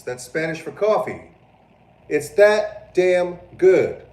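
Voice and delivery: high, loud hammed-up rant; mock outrage. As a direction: rant like coffee is sacred. Ranting about coffee haters.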